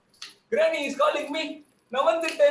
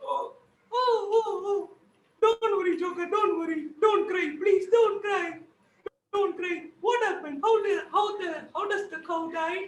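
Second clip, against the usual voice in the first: higher granny voice soothing him; comforting granny; 5 semitones higher, much slower than usual. Acted. Granny comforts the crying Joker in a higher voice. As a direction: hush him, don't cry.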